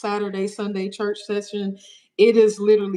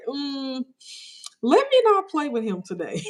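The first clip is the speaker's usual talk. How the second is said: put-on higher, wary little-kid voice; timid kid; 7 semitones higher than usual. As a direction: tiptoe around a scary dad, voice lifted. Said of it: "I'd be like".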